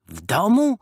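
high, loud ironic echo. A narrator's reading. Mambo mocks the idea of meeting at home, ironic scoff. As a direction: throw it back with a scoffing smile. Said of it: "Mambo uśmiechnął się ironicznie".

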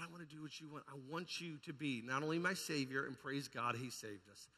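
usual speaking voice

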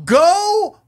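high-pitched, slow angry outburst. Real, not acted. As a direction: spit it out, dragging each word. Angry outburst at dog owners.

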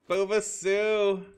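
high, loud, drawn-out shout of a name; loud sing-out hello; calling out. Real, not acted. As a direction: holler a greeting, stretching it out. Calls out to greet an arrival.

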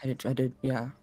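soft, halting, stammering wounded voice; hurt winged character. Acted. Injured winged friend reunited and comforting, soft halting stammer. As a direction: stammer softly through the pain. Emotional roleplay scene between characters.

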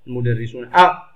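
المدرسون أ with a loud separate glottal a; hamza pronounced. Shown as a demonstration. Teacher showing a cutting hamza, loud detached أ after المدرسون. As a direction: break after المدرسون and punch the أ. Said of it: "أنطقها".

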